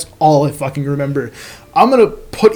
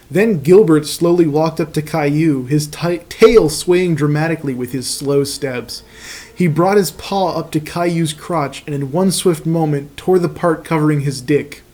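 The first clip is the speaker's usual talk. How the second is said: ordinary delivery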